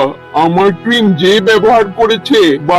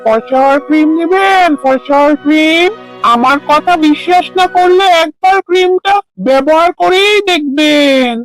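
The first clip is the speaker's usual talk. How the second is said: street vendor's cry; 6 semitones higher than usual. Acted. Seller calls passers-by to buy fairness cream, street vendor's cry. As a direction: hawk it down the street, stretching each phrase end.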